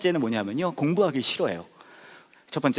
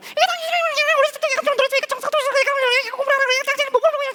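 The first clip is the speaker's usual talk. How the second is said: high, fast mock nagging at husband; shrill rapid complaint to the husband; nagging wife; 5 dB louder than usual. (남편에게 잔소리하는 아내)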